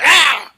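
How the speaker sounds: loud, high yell of frustration; imitated mad scream after a missed shot; mock angry yell. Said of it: "he was mad when he missed the shot".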